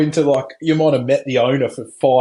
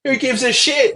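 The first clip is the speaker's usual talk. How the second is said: high, scoffing mock farmer; old farmer; 13 semitones higher than usual. Old farmer scoffing at the dead bird, high mock scoff. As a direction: toss it off like a dismissive old farmer. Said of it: "He's like".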